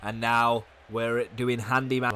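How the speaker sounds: ordinary delivery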